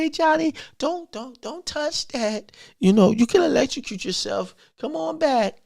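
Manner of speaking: mild coaxing. Shown as a demonstration. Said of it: "No, you're gonna say".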